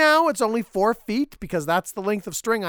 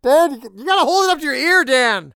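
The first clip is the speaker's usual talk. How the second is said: loud frustrated shout; 7 semitones higher, 7 dB louder than usual. Acted. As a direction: yell the instruction at him. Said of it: "Don't shout".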